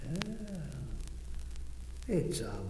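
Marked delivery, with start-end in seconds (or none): none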